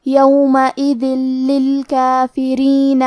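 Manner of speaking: یومئذ للکافرین with the tanween merged into ل, no ghunna, doubled ل; tajweed demo. Shown as a demonstration. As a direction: merge into للکافرین without nasalising it. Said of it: "بغیر غنہ سے لیکن تشدید کے ساتھ". (یومئذ للکافرین بغیر غنہ، تشدید کے ساتھ)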